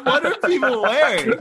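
high, loud, laughing exclamation; laughing disbelief. Real, not acted. Host mocking Coachella outfits to his co-hosts, laughing in disbelief. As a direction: cry it out in amused outrage.

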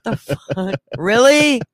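loud, high, disbelieving; can't believe he wasn't listening; exasperated disbelief. Real, not acted.

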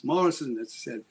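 ordinary delivery

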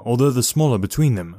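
usual speaking voice

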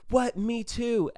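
high, astonished mock Australian; Australian accent. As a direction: react like a delighted Australian lad. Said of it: "these kids were also Australian".

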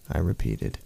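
ordinary delivery

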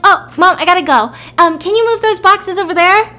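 calling out. Retold or imitated. Woman ends call, calls to movers.

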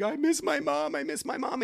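put-on high, whiny little-boy voice; imitating crying son. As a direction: mimic a sad small child missing mom. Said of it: "He was crying because, like".

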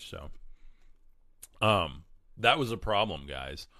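ordinary talk, nothing marked

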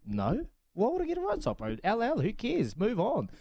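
mock-indignant, pitch jumping up on the question; retold dismissive reply. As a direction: brush off the fuss in a retold comeback. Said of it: "And I said".

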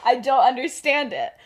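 baffled laughing. Acted. Remus baffled by Sirius's reaction.

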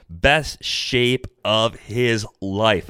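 slow, drawn-out quoting of a cliché; quoted cliché. Acted. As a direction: spell out the cliché slowly. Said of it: "common refrain".

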